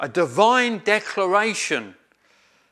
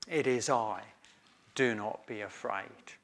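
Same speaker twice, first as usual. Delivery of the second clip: tender; 6 semitones lower, 8 dB quieter than usual. Acted. Softer repeat of comforting words.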